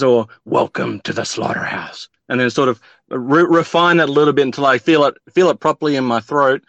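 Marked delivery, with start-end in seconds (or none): 0.4-2.1 low, slow drill sergeant growl; menacing drill sergeant, drawn out; Sergeant Slaughter voice. Acted. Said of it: "turns you into Sergeant Slaughter".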